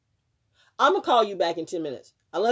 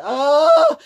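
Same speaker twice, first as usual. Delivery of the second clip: a loud, higher burst of alarm; retold panic, much louder than the talk around it; panicked gasp; 8 semitones higher, 14 dB louder than usual. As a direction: blurt it out in sudden fright. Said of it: "I was like".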